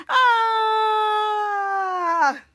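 warped scream. Retold or imitated. Drex getting warped, falling scream. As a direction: cry out as you vanish.

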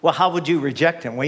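normal talking voice

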